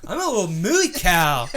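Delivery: slow high cutesy moo; cutesy cow call. Retold or imitated. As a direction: coo it like baby talk. Cow-calling bit.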